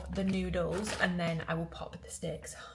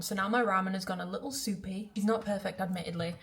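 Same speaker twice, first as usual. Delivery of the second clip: usual speaking voice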